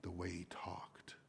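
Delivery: hushed dramatic whisper. Real, not acted. Preacher answering his own question, in a hushed whisper. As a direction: lean in and whisper it.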